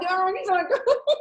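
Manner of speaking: a laughing high imitation of the baby; high mock baby coo. Imitating the little girl sucking her face, high and laughing. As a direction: coo it like a tiny happy baby. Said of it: "she's like".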